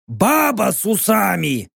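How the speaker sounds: jeering shout; mocking shout. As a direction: shout it out in scorn. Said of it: "насмешливо скривил губы орк".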